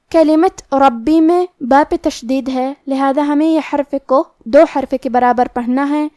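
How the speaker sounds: ordinary delivery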